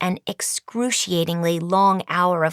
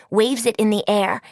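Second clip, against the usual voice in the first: ordinary delivery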